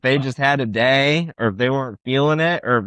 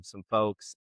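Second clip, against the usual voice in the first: ordinary talk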